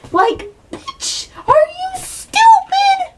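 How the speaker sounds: very high, slow, outraged squeal; high-pitched exasperated shriek; squealing disbelief. Real, not acted. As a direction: shriek at her like she's an idiot. Ranting at the character.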